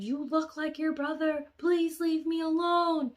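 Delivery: loud, high-pitched wail; acted cry at full volume, begging; desperate shouted plea. Girl begging the brother to leave, loud hysterical wail.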